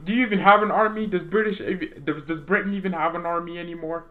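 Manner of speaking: high, fast and loud teasing jab; mock disbelief. Real, not acted. Teasing British viewers about their army, loud high mock disbelief.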